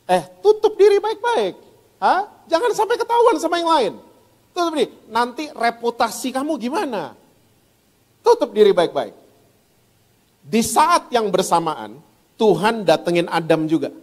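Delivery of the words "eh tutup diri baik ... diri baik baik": high, coaxing tempter; whisper-close devil urging secrecy, pitched well up; a lightly voiced devil egging them on; devil's voice. Preacher voices the devil again.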